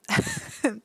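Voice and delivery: quick high burst of laughter. Real, not acted. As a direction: laugh at yourself. Laughing about wet shirt.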